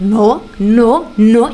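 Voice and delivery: mild mock yell of refusal; retold yelling. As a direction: raise the voice as if quoting a screamer. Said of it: "y empezó a gritar".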